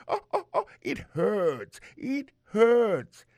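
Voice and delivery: high, loud yelps of pain; hammed-up ow, it hurts; mock pained cry. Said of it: "et puis il crie".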